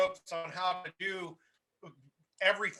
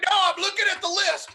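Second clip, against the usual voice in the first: louder and higher, insisting; pushing back over the phone, louder; insistent; 11 semitones higher, 10 dB louder than usual. Retold or imitated. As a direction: raise your voice to convince him. Quoting Leon insisting on phone.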